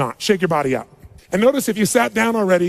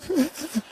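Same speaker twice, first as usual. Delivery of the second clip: imitated hearty laugh; 8 semitones higher, 7 dB quieter than usual. Someone laughing at own jokes.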